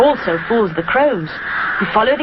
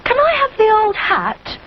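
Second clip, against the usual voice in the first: put-on high young bear asking; little bear voice; 14 semitones higher than usual. A narrator's reading. Rupert asking his mother, childlike. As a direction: ask like an eager small boy.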